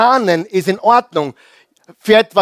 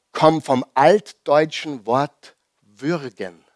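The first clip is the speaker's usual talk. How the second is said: normal talking voice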